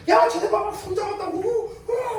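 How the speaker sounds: high, loud jeering shout of schoolkids; mock kids' teasing yell. Classmates yelling that two kids held hands, high loud jeering. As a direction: jeer at them like a classmate who caught them.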